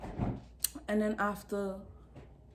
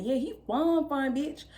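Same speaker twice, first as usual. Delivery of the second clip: higher-pitched, lingering "fine, fine"; 5 semitones higher than usual. Retold or imitated. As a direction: echo sister with long, high "fine, fine". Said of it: "that's how we talk".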